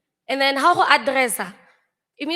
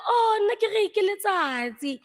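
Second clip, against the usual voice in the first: surprised realisation; 10 semitones higher than usual. Retold or imitated. Realising her mistake.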